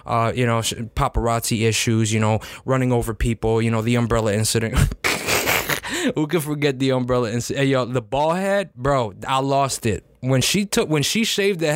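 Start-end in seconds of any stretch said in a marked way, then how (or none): 4.8-6.1 breathy laugh. Real, not acted. Laughs recalling umbrella incident.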